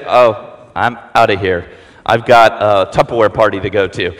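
low, mock old folks excusing themselves; deep, lazy old-timer slipping away; old folks' voice. Preacher voicing old folks sneaking away, low and dry.

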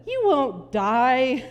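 loud, drawn-out serpent's line; serpent's taunt. Acted. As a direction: stretch it out like a tempting serpent. Serpent tempting Eve in story.

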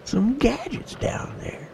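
hushed unvoiced whisper. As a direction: breathe it like a secret. Mocking whisper of movie line.

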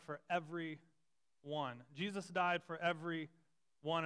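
everyone drawn out slowly and stressed; slow emphatic everyone. Shown as a demonstration. Pastor driving the point to the church, slow heavy stress on everyone. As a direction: lay weight on everyone, slowly. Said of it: "wanted you to see it written this way".

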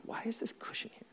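hushed, exasperated near-whisper; mock-frustrated whispery complaint; retold frustration. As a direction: grumble it under your breath, half-voiced. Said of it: "So I'm, like, so frustrated".

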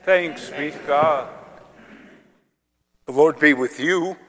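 usual speaking voice

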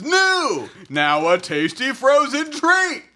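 mock commercial voice; bright announcer pitch.

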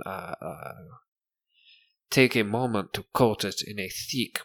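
softly spoken fake French merchant; horrible French accent. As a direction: pitch the wares in a bad, soft French accent. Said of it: "With this horrible French accent".

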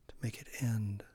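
ordinary talk

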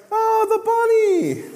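very high, slow amazed coo; delighted baby voice. Retold or imitated. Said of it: "And then they'll go".

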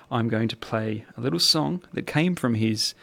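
ordinary talk, nothing marked

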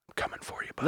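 whispered menacing threat; creepy whisper. Pretend. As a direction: breathe it like a stalker. Menacing killer line.

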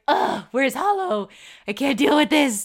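fed up with the fake AI, wanting the real one; disgusted groan.

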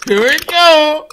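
high, loud, drawn-out shriek; excited shriek. Real, not acted. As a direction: squeal it out slowly with delight. Friends react to a bit.

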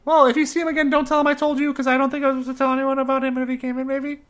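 put-on nervous tavern boy voice; nervous barkeep voice.

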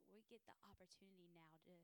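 ordinary talk

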